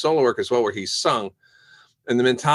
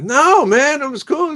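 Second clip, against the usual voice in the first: loud, high protest that all is fine; high-pitched reassurance; 14 semitones higher, 7 dB louder, slower than usual. Retold or imitated. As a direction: imitate a friend insisting, voice way up. Eric's reassurance, acted out.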